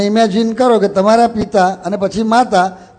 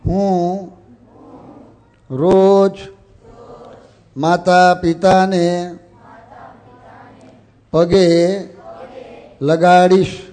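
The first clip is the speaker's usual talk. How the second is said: slow, drawn-out words with long pauses; measured call for group repetition; leading a repeat-after-me; 3 semitones lower, much slower than usual. Shown as a demonstration. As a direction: lead the pledge word by word, stretching each. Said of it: "હું બોલું એમ બોલો".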